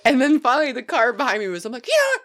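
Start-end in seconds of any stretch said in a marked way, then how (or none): 1.9-2.2 high shouted parting curse; angry yell. Retold or imitated. Telling the driver off at the pump, shouted. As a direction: spit it out up high. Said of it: "just yelling".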